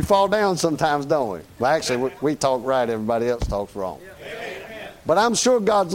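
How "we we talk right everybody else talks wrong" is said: ordinary delivery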